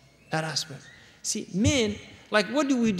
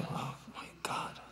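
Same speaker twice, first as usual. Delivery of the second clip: breathless whisper of disbelief, hidden from her; hushed aside; 16 dB quieter than usual. Retold or imitated. Husband hearing his wife's chatter about her friends, whispered. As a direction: whisper it under your breath so she can't hear.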